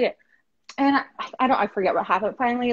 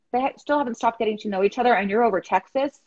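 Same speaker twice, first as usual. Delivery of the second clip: ordinary delivery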